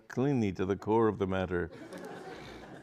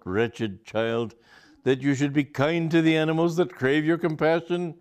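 higher and louder, a pious lecture; pompous sermonizing; 7 semitones higher, 6 dB louder than usual. A narrator's reading. Aubrey performs moral outrage for Charlotte, hammed-up lecture. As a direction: preach at the boy with theatrical virtue. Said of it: "Aubrey started dramatically".

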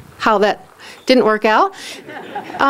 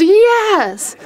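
high, emphatic acted-out yes; eager yes; 9 semitones higher than usual. Husband answering the invitation home, eagerly. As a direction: agree instantly, pitched right up.

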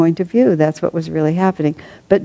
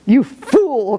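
higher, laughing insult; laughing cry; 7 semitones higher than usual. A narrator's reading. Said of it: "cried his wife".

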